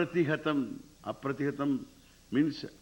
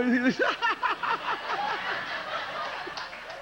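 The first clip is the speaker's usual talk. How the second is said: a big high laugh; high hearty laugh. Real, not acted. Laughs at his own mock offer.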